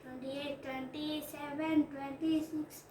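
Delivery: soft steady countdown; quiet countdown. Real, not acted. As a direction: count down softly under breath. Counting down the timer.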